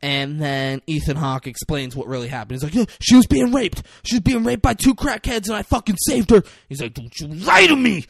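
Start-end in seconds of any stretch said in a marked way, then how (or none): 2.7-6.4 high, loud frantic explanation; super intense pleading. Retold or imitated. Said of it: "It's so fucking intense". 6.9-8.0 high, loud mock-angry challenge; intense accusation. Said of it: "It's so fucking intense".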